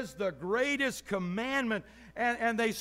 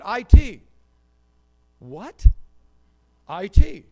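blurted answer; 15 dB louder, slower than usual. Retold or imitated. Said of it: "He said".

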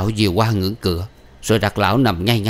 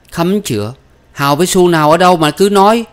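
high, loud, quick retort; indignant denial; 9 semitones higher, 7 dB louder than usual. Acted. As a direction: protest the accusation hotly. Daughter denies the accusation.

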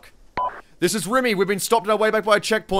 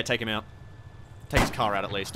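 ordinary talk

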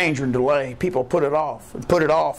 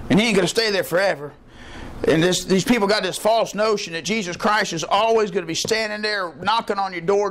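ordinary talk, nothing marked

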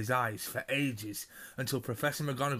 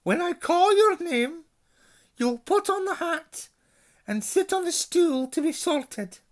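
much higher, louder, crisp stern teacher voice; Professor McGonagall; 6 dB louder than usual. A narrator's reading. McGonagall instructs the first-years, high and commanding. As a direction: announce it like a strict headmistress. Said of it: "Professor McGonagall now stepped forward".

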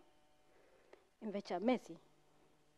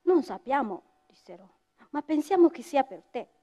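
measured storyteller's voice with music under it; story narration; 2 semitones higher, 10 dB louder than usual. Acted. Storyteller telling an audience the coffee legend, measured and solemn.

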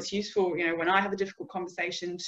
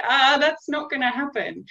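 higher, louder amused refusal; scoffing dismissal; 7 semitones higher, 6 dB louder than usual. Acted. Client dismissing a fitness coach's strict plan, amused scoff. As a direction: laugh off the idea with a high "ah". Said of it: "I'd just laugh and say".

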